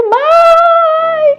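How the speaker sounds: high, loud cry of Mom; retold child's shout. Little son screaming for his mom as the family walks away, loud and high. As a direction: yell for your mother, panicked. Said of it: "ele deu um grito".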